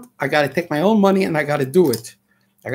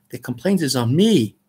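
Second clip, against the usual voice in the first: usual speaking voice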